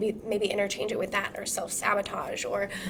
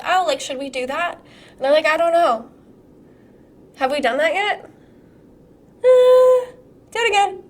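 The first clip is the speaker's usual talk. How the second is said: higher, louder mock dithering team; hesitant team; 8 semitones higher, 9 dB louder than usual. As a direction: act out clueless helpers, pitched up and loud. Acting out Edison's doubtful team.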